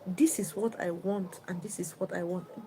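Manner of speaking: softly, almost whispered, calm; calm quiet demand. Retold or imitated. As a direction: quietly lay out what you want. Husband calmly states demands.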